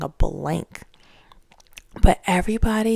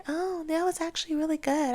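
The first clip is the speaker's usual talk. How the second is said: much higher, softer mock fan; mock gushing fan; 9 semitones higher, 8 dB quieter than usual. Vlogger mimicking a fan decades later, high and soft. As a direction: coo it like a fan who changed their mind. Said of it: "Twenty years later".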